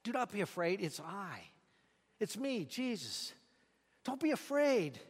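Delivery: calm them gently, barely above a breath; quiet voice. A narrator's reading. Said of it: "this quiet voice saying to him".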